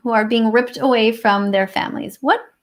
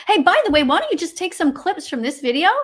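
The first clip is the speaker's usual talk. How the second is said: ordinary talk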